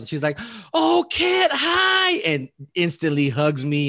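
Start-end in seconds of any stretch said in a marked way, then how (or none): 0.7-2.1 high, loud squeal of recognition; hammed-up excited hi; imitated delighted greeting. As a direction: mimic a gushing, high-pitched hello. Retells her delighted greeting.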